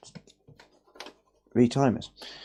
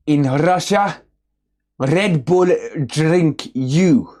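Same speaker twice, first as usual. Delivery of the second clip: louder, higher, slow hammed-up Russian accent; mock Russian accent; 4 semitones higher, 4 dB louder, slower than usual. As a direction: punch the Russian joke like a comic. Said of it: "Which is why the Russian accent".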